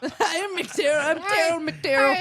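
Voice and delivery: hammed-up Irish brogue with a giggle; mock Irish accent. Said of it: "like an Irish Terrell".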